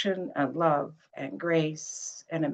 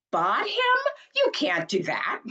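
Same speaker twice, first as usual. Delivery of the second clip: put-on much higher, scandalised old mother; outraged at buying a person; shocked mother; 9 semitones higher than usual. Mother in assisted living scolding Brett, much higher and shocked. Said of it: "Brett and Mom in Assisted Living".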